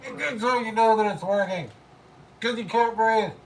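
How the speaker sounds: muffled behind a gas mask; through a gas mask. Real, not acted. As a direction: voice trapped inside a mask. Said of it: "So I'm gonna put on the gas mask".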